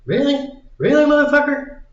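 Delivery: high-pitched incredulous mock outburst; imitating disbelief. Signer realizing the contract trap, high disbelief. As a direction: play the stunned signer finding the trap, pitch way up. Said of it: "That's gotta be what that dude was thinking".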